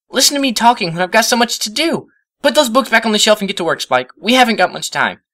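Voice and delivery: high, loud and hurried; frantic rush of orders; panicking. A narrator's reading. Twilight orders Spike to tidy up, high panicked rush. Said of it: "Twilight resumed panicking".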